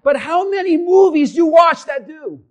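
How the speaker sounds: forceful rhetorical challenge; full-force preaching. Acted. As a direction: drive the question home at the crowd. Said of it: "preaching at full force".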